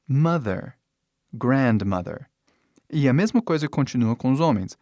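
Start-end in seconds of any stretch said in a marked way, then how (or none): 0.1-0.8 Mother said with full English th and r, MUH-ther; English model word. Shown as a demonstration. Teacher to students, careful American pronunciation. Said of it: "São mother". 1.3-2.3 Grandmother said with English r and th, GRAND-muh-ther; English model word. Shown as a demonstration. Teacher to students, careful English pronunciation. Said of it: "São mother".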